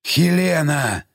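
drawn-out, hushed creature voice; restrained, rumbling plea; subdued monster call. A narrator's reading. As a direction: call the name as a quieted giant. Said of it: "повторил ... Юзеф уже тише".

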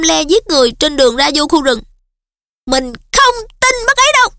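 rising agitated protest; heated outcry. A narrator's reading. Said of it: "Hermione nóng nảy kêu lên".

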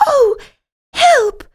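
high, loud squeak of fright; a tiny mouse crying out in panic; frightened cry. A narrator's reading. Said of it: "It looks at us and cries".

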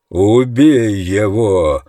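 loud, long drawn-out yell. A narrator's reading. Friend orders Roland to kill the colonel, yelling. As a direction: stretch the order into a roar. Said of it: "заорал друг".

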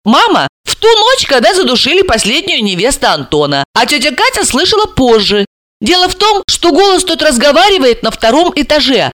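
high, animated storytelling character; Alisa. Acted. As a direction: play Alisa recounting it eagerly.

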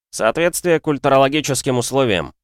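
ordinary delivery, nothing marked

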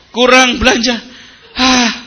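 high-pitched, huffy mock grumble; hammed-up exasperation; mock complaining. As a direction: whine and huff like a habitual complainer. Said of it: "diselesaikan dengan ngeluh".

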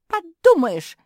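very loud, high scoff; defiant interruption. A narrator's reading. Жози interrupting the sister in charge, scoffing. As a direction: cut in with brazen contempt. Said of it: "перебила её Жози".

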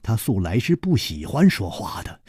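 usual speaking voice

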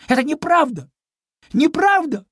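high, loud indignant cry; exclaiming piglet. Acted. Piglet protesting to the cat, loud and high. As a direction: shout it out, hurt and protesting. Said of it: "воскликнул Чуня".

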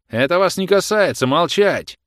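high, loud command to silence; officer's shout. A narrator's reading. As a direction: shout her down with authority. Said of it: "крикнул офицер, вставая".

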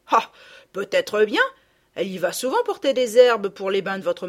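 countrywoman musing, building to a loud threat; rustic speculation hardening into loud severity; talkative peasant gardener. A narrator's reading. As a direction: muse aloud, then threaten a whipping firmly. Voices the gardener.

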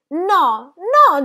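no acutissimi e forti, quasi un lamento; gemito. A narrator's reading. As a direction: geme il suo rifiuto. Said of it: "gemette Gigi". (Gigi geme contro la zia, acuto e forte)

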